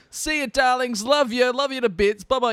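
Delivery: high, loud, camp goodbye to friends; gushing farewell. Pretend. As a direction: gush the farewell up high like a luvvie.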